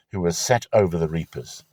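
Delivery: ordinary delivery, nothing marked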